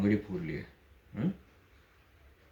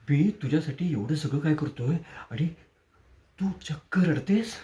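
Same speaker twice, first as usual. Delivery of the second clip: higher, slowing on the end, sarcastic; mock hurt; 5 semitones higher than usual. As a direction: play the wounded benefactor. Taunts crying woman. (उपहासाने दुखावल्याचा आव)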